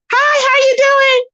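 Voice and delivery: high, drawn-out mock-yelled hello; hammed-up shouty greeting; mock yelling greeting. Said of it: "and be yelling".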